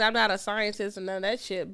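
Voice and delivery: ordinary talk, nothing marked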